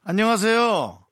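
slow, loud radio-host hello; bright loud greeting. Real, not acted. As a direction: greet the caller warmly and loud. Greeting caller on phone. (전화 받은 청취자에게 크게 인사)

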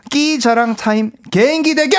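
high, drawn-out game show call; hyped announcement. Real, not acted. Host announcing a talent contest, hyped announcer call. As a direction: stretch out the round title, slow and high.

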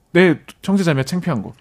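ordinary talk

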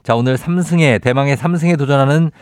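ordinary talk

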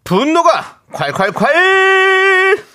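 slow, high, drawn-out title call; dramatic segment title. Acted. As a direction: boom out the segment name.

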